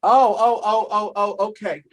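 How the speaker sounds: ordinary delivery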